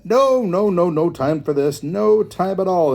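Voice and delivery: loud, high impatient hare; interrupting. A narrator's reading. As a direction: burst in and cut them off. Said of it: "interrupted the hare".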